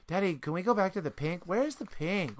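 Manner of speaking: voiced small child asking insistently; imitating eager daughter. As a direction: play a little girl begging Dad. Acting out daughter's repeated request.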